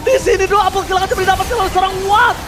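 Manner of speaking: building from quick talk to high, loud; esports caster. Real, not acted. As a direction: ramp up the hype with the fight. Caster building to team fight.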